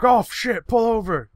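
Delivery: high, loud urgent yell; coworker's high-pitched cry, acted out; imitated alarmed shout. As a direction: yell like a panicked passenger. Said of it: "Joe's like".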